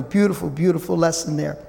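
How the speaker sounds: normal talking voice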